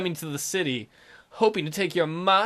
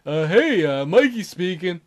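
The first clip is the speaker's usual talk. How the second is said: lightly lowered man's voice; deep voice; 4 dB louder than usual. Acted. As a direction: put on a deep voice for Mikey. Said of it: "the only kind of deep voice I have".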